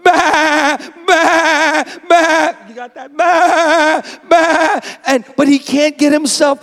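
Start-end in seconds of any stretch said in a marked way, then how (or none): 0.0-2.5 high, loud frantic bleating; high loud mock bleats of a dying sheep; panicked sheep bleat. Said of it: "So he starts". 3.2-4.8 high, loud frantic bleating; high loud mock bleats of a dying sheep; panicked sheep bleat. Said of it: "So he starts".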